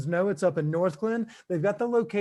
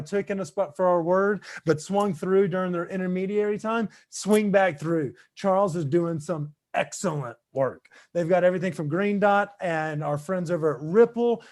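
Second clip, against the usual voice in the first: ordinary delivery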